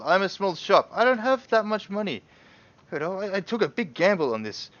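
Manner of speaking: high, loud haggling plea; protesting merchant. Acted. Small shopkeeper haggling over price, loud high protest. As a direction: plead poverty, pitched right up.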